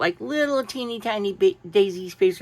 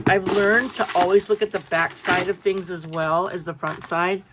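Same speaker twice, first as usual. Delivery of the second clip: usual speaking voice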